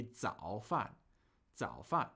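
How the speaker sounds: 早饭 with a dipping third tone, then a falling fourth; correct tones. Shown as a demonstration. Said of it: "That would be third tone, fourth tone".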